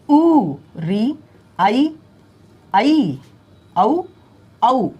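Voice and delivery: ऊ and री stretched very slowly; slow vowel drill. Shown as a demonstration. Teacher reciting matra sounds slowly. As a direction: draw each vowel sound out. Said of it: "जिसको आप कहते हैं ई".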